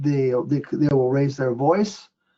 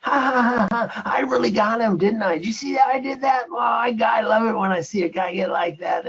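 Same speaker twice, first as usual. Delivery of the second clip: diabolical laughter; 8 semitones higher than usual. Retold or imitated. Older FDA inspector gloating outside after rattling a manager, diabolical laughter. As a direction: cackle like a cartoon villain savoring his win. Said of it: "he starts laughing diabolical".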